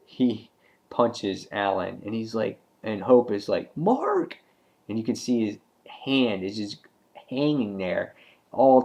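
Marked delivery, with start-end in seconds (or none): 3.8-4.4 very high mock cry of alarm; retold horrified call, pitched way up. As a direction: imitate calling out in shock. Hope reacts to gruesome injury.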